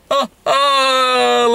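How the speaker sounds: high, drawn-out "oh" imitating skiers; mock whooping along with the skiers; mimicked skier noises. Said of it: "like he's mimicking the skiers".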